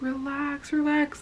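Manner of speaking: high, soft drawn-out reassurance; sing-song mock soothing; mimicked soothing. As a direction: imitate men calming someone down, lightly and high. Mimicking men soothing the panicked traveler.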